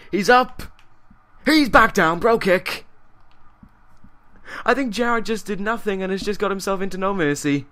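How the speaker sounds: high, loud commentator yell; excited finish call. Real, not acted. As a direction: yell the winning kick as it lands. Live call of match finish.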